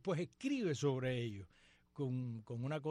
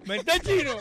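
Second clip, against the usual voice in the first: usual speaking voice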